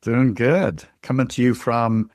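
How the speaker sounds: usual speaking voice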